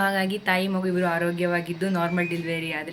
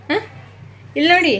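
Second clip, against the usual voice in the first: much higher and louder, bright coaxing; 10 semitones higher, 8 dB louder, faster than usual. Real, not acted. Mother praising her baby son and calling him to look, high loud baby talk.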